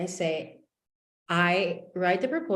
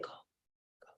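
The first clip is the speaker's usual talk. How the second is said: unvoiced, very soft whisper; whispered inner voice; 25 dB quieter, slower than usual. Inner voice urging her to go, whispered. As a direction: whisper it like a voice in your head. Inner voice she heard, acted out.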